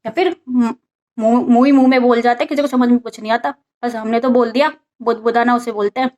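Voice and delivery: ordinary talk, nothing marked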